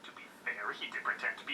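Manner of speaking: hushed unvoiced aside; whispering. Real, not acted. Muttered aside about Bruce.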